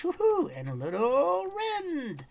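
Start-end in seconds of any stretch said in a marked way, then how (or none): 0.0-0.5 high, loud whoop of joy; excited woohoo. Real, not acted. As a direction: let out a gleeful whoop. Cheering during combat.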